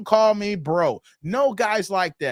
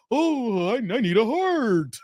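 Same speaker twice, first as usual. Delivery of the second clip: higher, slow whimpering lion bit; cowardly lion; 4 semitones higher, slower than usual. Pretend. Streamer jokes that she looks like the cowardly lion, mock cowardly lion voice. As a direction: put on a plaintive, drawn-out lion voice. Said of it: "look like the cowardly lion".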